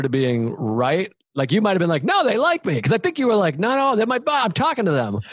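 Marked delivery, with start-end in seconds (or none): 2.0-2.8 higher-pitched mock protest; lightly voiced oblivious friend; imitated naive insistence. 3.6-5.1 higher-pitched mock protest; lightly voiced oblivious buddy; imitated naive insistence. Imitating friend insisting on chatting.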